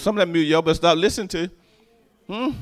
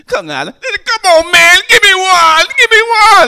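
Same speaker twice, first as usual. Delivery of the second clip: high, slow, pleading whine with a laugh; begging men; 13 semitones higher, 9 dB louder, slower than usual. Retold or imitated. Street men pleading with the preacher, high pleading whine. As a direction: beg for it like your life depends on it.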